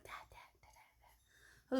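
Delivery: voiceless, repeated whispered call; imitated whisper. Mother copying her child calling down the hallway, whispering. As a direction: whisper it urgently, mimicking the child. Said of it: "Why are you whispering down the hallway?".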